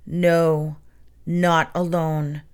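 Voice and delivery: low, slow and silky; an unseen spider answers the boys, eerie and slow. A narrator's reading. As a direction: purr it like a lurking monster. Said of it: "an eerie, silky voice".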